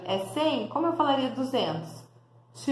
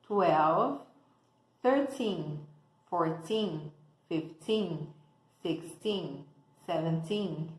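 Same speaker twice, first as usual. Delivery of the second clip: slow, clear counting for learners to repeat; teacher counting drill; 4 semitones lower, much slower than usual. Shown as a demonstration. Teacher counting slowly for students to repeat. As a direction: count each number out for the students.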